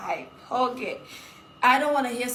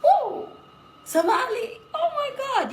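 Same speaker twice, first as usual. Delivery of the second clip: high, loud whoop; excited cheer; 14 semitones higher, 5 dB louder, slower than usual. Real, not acted.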